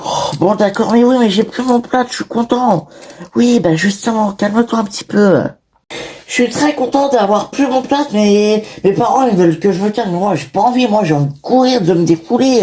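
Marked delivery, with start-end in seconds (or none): 0.0-2.9 put-on higher, bouncy voice of an overexcited little boy; excited child. 6.3-12.6 put-on high, eager boy's voice bursting with energy; a kid who just wants to run; excited child.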